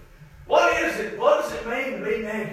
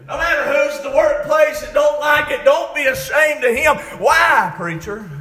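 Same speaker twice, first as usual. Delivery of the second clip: full-voiced, driving sermon delivery; impassioned preaching; 3 semitones higher, 6 dB louder than usual. Real, not acted. Preacher urging the church to be ashamed of sin, impassioned preaching. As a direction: preach it hard to the congregation.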